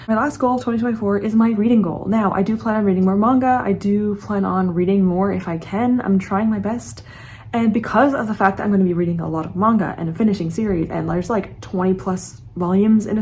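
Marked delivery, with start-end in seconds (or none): none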